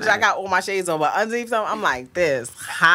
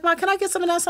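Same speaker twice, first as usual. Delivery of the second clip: imitating her daughter; 13 semitones higher, faster than usual. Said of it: "She's like".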